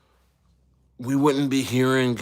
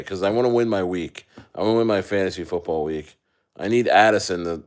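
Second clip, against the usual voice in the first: usual speaking voice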